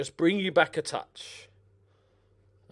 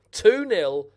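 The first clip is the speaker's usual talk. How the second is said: loud, higher "two nil"; pleased; 7 semitones higher, 9 dB louder than usual. Real, not acted. Gamer relishes his two-goal lead. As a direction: savour the lead.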